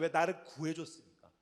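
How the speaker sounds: ordinary talk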